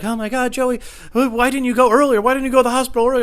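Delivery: high, pleading family voice; imitated distraught family. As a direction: act out relatives pleading in a high voice. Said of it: "they're gonna be like".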